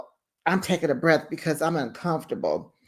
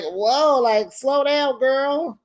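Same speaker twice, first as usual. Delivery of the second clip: high, slow, sassy whoa; hammed-up slow down girl; mock slow down; 10 semitones higher, slower than usual. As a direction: drag out slow down teasingly. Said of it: "and it was like".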